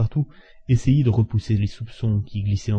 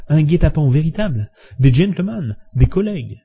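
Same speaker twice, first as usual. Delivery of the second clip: louder, higher tipsy indignation; animated exclamation; 6 semitones higher, 4 dB louder than usual. A narrator's reading. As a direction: protest with rising heat from the brandy. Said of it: "qui s'animait alors sous l'influence du brandy". (exclamation animée et indignée)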